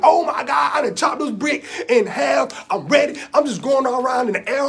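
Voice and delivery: loud, high excited outburst. Acted. Boy bragging after chopping a brick in half, excited. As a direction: burst out thrilled and loud.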